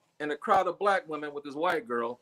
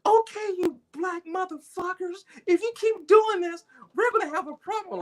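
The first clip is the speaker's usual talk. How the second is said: Shauna threatening; 14 semitones higher than usual. Retold or imitated. Girlfriend standing up to the women, high mock threat. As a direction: imitate her squaring up in a high voice. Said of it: "She goes".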